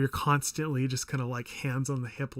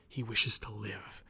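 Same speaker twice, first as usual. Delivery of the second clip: whisper; 5 semitones lower, 5 dB quieter, slower than usual. A narrator's reading. Reading a wish, dropped to whisper.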